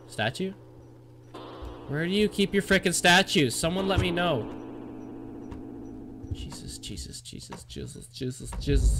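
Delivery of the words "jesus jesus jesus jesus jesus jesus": very soft, breath-only repetition; frightened whisper. Real, not acted. Player in creepy tension with the ghost, frightened whispering. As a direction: whisper it over and over in dread. Hushed panic near the ghost.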